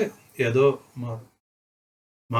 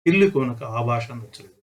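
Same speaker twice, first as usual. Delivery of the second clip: ordinary delivery, nothing marked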